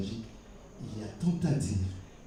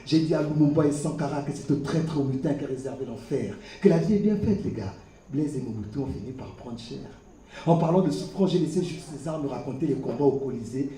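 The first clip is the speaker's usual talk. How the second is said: ordinary talk, nothing marked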